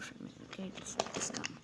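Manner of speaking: hushed whispered coaxing; plotting engine whispering to James. Acted. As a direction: whisper it conspiratorially. Luring James away.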